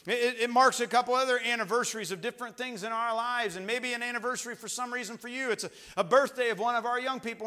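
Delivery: loud, high-pitched pulpit delivery; urgent preacher at full volume; full-force preaching. Real, not acted.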